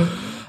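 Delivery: a sharp, hushed gasp of shock. Acted. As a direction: gasp as if caught red-handed. Receptionist shocked.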